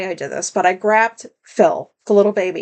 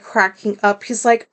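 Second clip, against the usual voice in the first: ordinary talk, nothing marked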